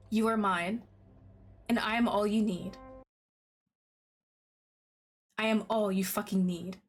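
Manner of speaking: lightly voiced threat beneath the devotion; possessive, menacing lover. Voices possessive love interest's threats.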